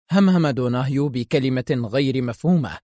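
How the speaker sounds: usual speaking voice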